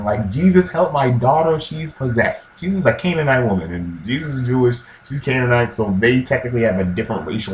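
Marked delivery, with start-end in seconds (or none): none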